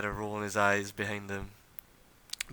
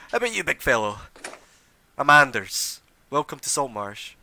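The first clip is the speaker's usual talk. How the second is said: lightly higher, louder friendly lord; hearty noble; 4 semitones higher, 7 dB louder than usual. Acted. Anders welcomes the big fighter, a lightly raised hearty voice. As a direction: clap the big man on the back with words. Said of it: "comes over to you and says".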